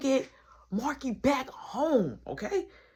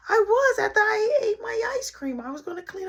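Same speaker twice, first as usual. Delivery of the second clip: put-on high, whining crying-kid voice; imitating a crying kid; whiny little kid; 12 semitones higher, 4 dB louder than usual. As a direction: whimper like a caught little boy. Little brother voiced caught.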